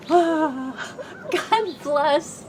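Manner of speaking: choking up with real tears; tearful. Real, not acted. Talking to the Habitat volunteers, real tearful voice breaking. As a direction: voice catching on a sob, pitched high. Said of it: "now I'm gonna cry".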